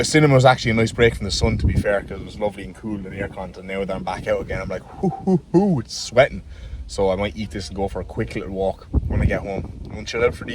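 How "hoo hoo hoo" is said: high hoots of puffed-out breath; overheated hooting. Acted. Back out in the sun from the cool cinema, overheated hooting. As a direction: hoot as if hit by the heat. Acts out stepping back into heat.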